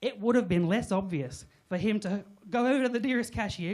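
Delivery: usual speaking voice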